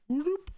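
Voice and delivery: sudden high whoop as it tips. Retold or imitated. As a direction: yelp it like the boat lurches. Imitating the raft tipping.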